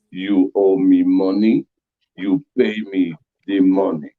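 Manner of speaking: low, very slow Russian accent; heavy, deliberate mock Russian. Shown as a demonstration. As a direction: demand it slowly in a deep Russian accent. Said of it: "I got a little Russian in me".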